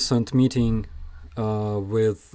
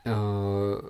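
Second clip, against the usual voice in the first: normal talking voice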